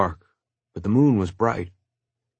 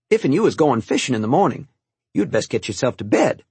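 lightly voiced old cook, a bit quick; old cook Martha; 5 semitones higher than usual. Cook sending the boy to bed, lightly voiced. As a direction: hurry him off to bed kindly.

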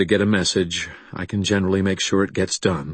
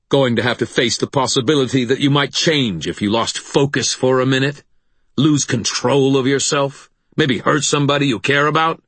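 higher, louder mocking questions; angry taunting; 7 semitones higher, 4 dB louder than usual. A narrator's reading. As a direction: taunt him, building with each question. Angry brother mocks narrator's fears.

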